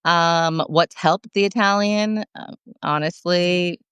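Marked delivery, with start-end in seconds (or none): none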